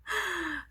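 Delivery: high, awestruck intake of breath; acted-out gasp of awe; admiring gasp. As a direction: gasp as if starstruck by the friend. Acting own awed reaction to friend.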